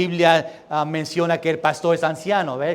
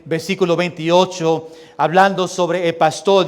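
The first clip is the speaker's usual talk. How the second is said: usual speaking voice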